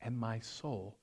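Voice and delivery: soft, low murmured communion prayer; mumbled prayer. Shown as a demonstration. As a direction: murmur it under your breath like a congregation. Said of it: "Some of you hear us mumbling up there".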